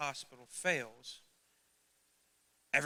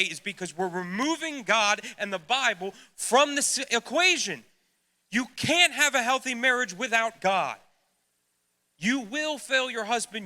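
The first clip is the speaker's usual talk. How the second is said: much higher, loud preaching climax; impassioned preaching; 7 semitones higher, 12 dB louder than usual. Acted. Preacher warns the couples, loud rising fiery preaching. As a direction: build to a fiery warning.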